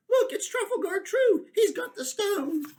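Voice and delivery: high, loud excited calling; high excited creature voice; excited shout. Acted. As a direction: point up at the sky thrilled. Creatures spot Trafalgar.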